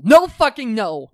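loud, high retold outburst; mock-outraged cutting in; outraged refusal. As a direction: burst out with a drawn-out no. Acted refusal of the festival.

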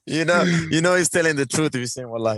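high, laughing through the line. Real, not acted. Guest laughing at the host's wallahi, teasing. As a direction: tease him while laughing.

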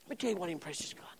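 fast, unvoiced whisper. Shown as a demonstration.

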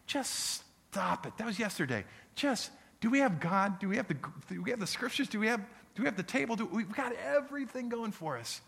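high, fast, rising urging; urgent pep talk. Pretend. Pastor voicing a church that lets go, excited urging. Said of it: "and just go".

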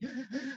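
high gasp caught in a sob; sobbing gasp. Retold or imitated. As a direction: gasp between sobs, mimicking the weeping woman. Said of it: "crying so hard, she sounded like she was choking".

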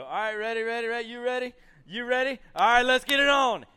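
high, loud call building to a yell; referee's hyped shout. Retold or imitated. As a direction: fire up the fight as referee. Acts referee starting the fight.

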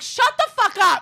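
high, drawn-out mock yell. Cyclist to an old man at the light, yelling. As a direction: imitate yelling back in a high, stretched voice.

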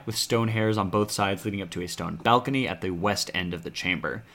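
ordinary delivery

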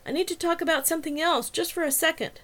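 high-pitched urgent appeal to a group; urgent calling out. A narrator's reading. Marcy asking the dorm women for a second, urgent and high. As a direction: keep the pitch up, asking for attention. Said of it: "she called out urgently".

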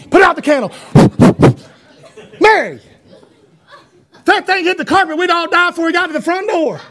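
laughing mock alarm; daddy's panicked warning. Son retelling daddy's fire warnings with laughter. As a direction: warn of doom while laughing. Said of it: "we'd have birthday parties".

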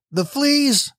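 can't believe what she's hearing; a reader's lightly voiced disbelief; incredulous. A narrator's reading. Corrie balks at thanking for fleas.

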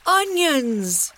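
high happy call of "Onions!"; boy shouting at the smell of stew; excited shout. A narrator's reading. Boy greeting the stew, a bright excited cry. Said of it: "he shouted".

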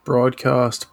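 broadcast with the Australian open aw vowel. Shown as a demonstration. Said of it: "do those two words not rhyme in an Australian accent?".

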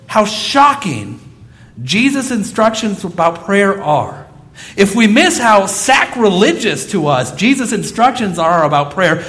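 ordinary talk

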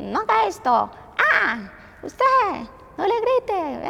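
high-pitched mock scolding yells; regaño a gritos. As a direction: imitate a mom forever yelling at kids. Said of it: "siempre estamos es gritándole a los niños". (mamá regañona gritando, imitada)

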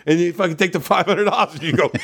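normal talking voice

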